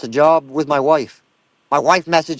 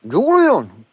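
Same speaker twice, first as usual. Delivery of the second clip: Bubbles impression; 2 semitones higher than usual. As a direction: play a worried, kindly man fussing over cat food.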